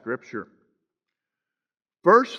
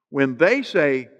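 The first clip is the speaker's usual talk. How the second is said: THEY lifted above the other words; stress on THEY; 3 semitones lower, slower than usual. Real, not acted. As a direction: lean firmly on "they". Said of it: "now with emphasis on the word they".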